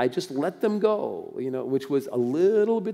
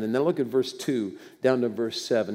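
ordinary delivery

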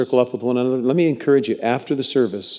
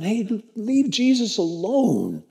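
higher, slow, a touch of a scolding voice; hushing disciples; 9 semitones higher, slower than usual. Acted. Disciples telling the blind man to hush, lightly voiced. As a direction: shoo him off, mildly stern.

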